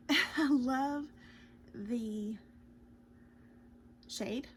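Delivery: laughing through a hiccup; a hiccup breaks the words. Real, not acted. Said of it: "sorry, I've got the hiccups".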